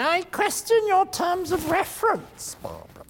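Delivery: high, mocking know-it-all jury critic; smart-ass critic. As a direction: sneer it up like a smug young critic. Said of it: "smart-ass young critics who say".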